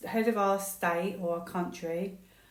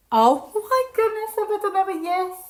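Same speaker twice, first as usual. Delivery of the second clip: high, loud squeal of delight at the card; excited shriek; 12 semitones higher, 10 dB louder than usual. Real, not acted. Tarot reader squealing over the card to viewers, excited. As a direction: gasp it out, much higher and louder, thrilled.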